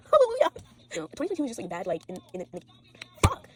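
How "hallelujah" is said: much higher and louder, laughing hallelujah; laughing praise cry; mock hallelujah. Real, not acted. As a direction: belt it like a joyful shout. Laughing relief after near death.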